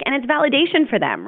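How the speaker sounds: ordinary talk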